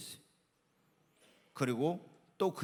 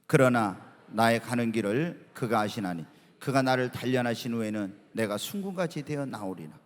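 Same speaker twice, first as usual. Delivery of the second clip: measured, lower recitation of the verse; scripture reading; 5 semitones lower, 4 dB louder than usual. Shown as a demonstration. Pastor reading Job aloud with the congregation, measured. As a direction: read the verse aloud together. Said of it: "같이 읽습니다".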